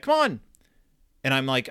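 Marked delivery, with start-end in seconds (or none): 0.0-0.4 high, raised impatient call; dad calling out. Retold or imitated. Dad calls son still frozen by the lantern, high impatient call. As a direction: hurry the kid along from afar.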